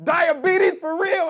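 loud, high indignant outburst; mock berserk rant. Comic yelling at the host, loud mock outrage. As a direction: act out an offended comic losing it. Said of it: "And the guy went berserk".

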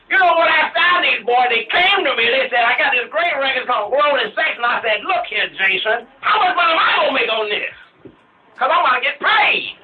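high, loud, animated Joe Jackson impression. Host shows Joe Jackson how animated his impression is. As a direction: do a pushy, money-hungry Joe Jackson. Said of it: "you're more animated".

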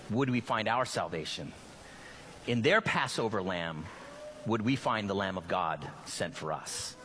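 prayer. Acted. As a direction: pray it gently, leading the room.

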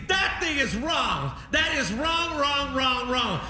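put-on high, slow insistent old-man protest; mock stubborn dad. Son imitating father denying the step count, slow high protest. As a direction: drag out each wrong like a stubborn father.

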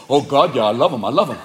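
low, eager dog voice; devoted dog. Acted. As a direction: gush like a simple, adoring dog. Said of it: "cat mind fucks your dog".

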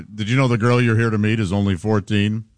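lightly voiced stern TV host confronting a suspect; host grilling a predator, low and stern; Chris Hansen bit. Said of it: "Chris Hansen things".

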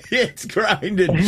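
real laughter breaking into speech; laughing. Real, not acted. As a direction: laugh helplessly through the words. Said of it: "I'm sorry for laughing".

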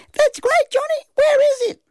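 extremely high falsetto mum; mum's voice. A narrator's reading. Mum answers Johnny's news, high falsetto. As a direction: pipe it up in a squeaky falsetto. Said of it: "Mum said".

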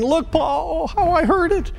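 put-on high, whiny little boy showing his pinched finger; suffering little boy. A narrator's reading. Son to his busy pastor father, high pleading child voice. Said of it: "said with an expressive suffering".

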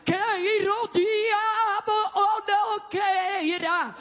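fervent revival preaching. Real, not acted. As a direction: preach with forceful, stretched-out words to rouse the church. Preaches at full force to church. (pregação pentecostal fervorosa)

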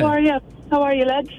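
put-on Irish accent. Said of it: "Oh, a bit of an accent".

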